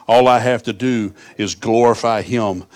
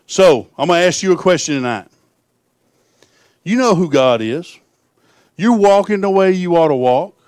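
higher, louder, slow preaching build; 9 semitones higher, 5 dB louder than usual. Acted. Preacher challenges congregation, preaching at full force. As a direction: preach it out to the room.

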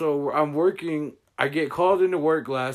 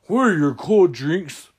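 slow dumb customer; 5 semitones higher, 6 dB louder than usual. Retold or imitated. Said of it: "he goes".

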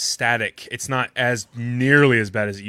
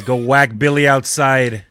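loud, slow gangster voice; Rat the mob boss; 8 dB louder than usual. Acted. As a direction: give the order like a mob boss. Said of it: "He says".

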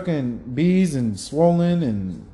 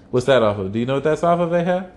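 ordinary talk

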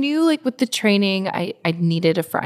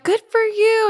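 high-pitched imitation of well-wishers; retold gushing encouragement; cheering onlookers; 3 dB louder than usual.

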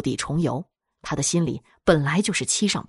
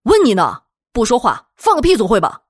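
loud, higher-pitched angry demand; impatient angry outburst; 5 semitones higher, 7 dB louder than usual. Acted. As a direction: shout it, fed up with getting no answer. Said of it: "有些急躁地大怒道".

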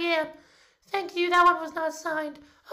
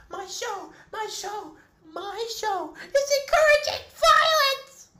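distraught wail; 3 dB louder, slower than usual. Acted. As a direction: wail it in horror. Nutmeg horrified about own show.

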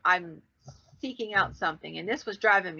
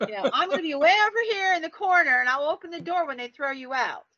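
friend backing off; 8 semitones higher, 3 dB louder, faster than usual. Retold or imitated. Mimics embarrassed friend backing away.

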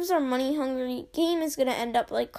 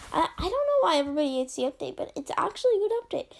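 rushed sarcastic; 3 semitones higher, much faster than usual. Pretend. Fast mock defense of update.

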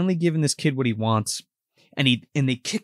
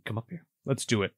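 much softer, lower, ominous; quiet invitation; 5 semitones lower, 9 dB quieter than usual. Retold or imitated. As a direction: murmur the challenge darkly. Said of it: "And he's like".

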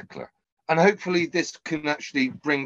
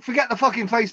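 higher, playful jab; mock scolding; 9 semitones higher, faster than usual. Real, not acted. Host teasing Gabe, mock scolding. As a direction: wave off his point cheekily.

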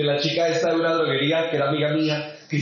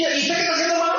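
indignant challenge; 10 semitones higher, much faster than usual. Acted. Challenges passive church members.

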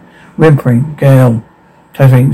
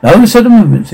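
ringmaster; 7 semitones higher, 3 dB louder, faster than usual. Acted.

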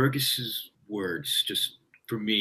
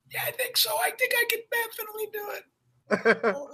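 high falsetto, much higher, meek; squeaky mock reply. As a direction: squeak it out like a nervous kid. Mock-timid reply to director.

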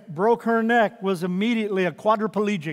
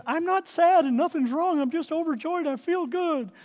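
overjoyed daughter; 9 semitones higher than usual. Retold or imitated. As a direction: lift up into your daughter's tearful joy. Daughter's words voiced in story.

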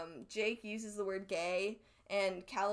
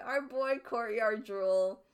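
slow fond naming; 6 dB louder, slower than usual. Shown as a demonstration. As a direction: stretch out our boy Courtyard Drool slowly and warmly, a little louder. Repeats the name slowly, fondly.

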